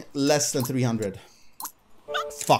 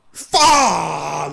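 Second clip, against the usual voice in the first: frustrated shout; 5 dB louder than usual. Real, not acted. Losing spin on slot.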